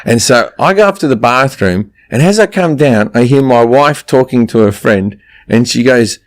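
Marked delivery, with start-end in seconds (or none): none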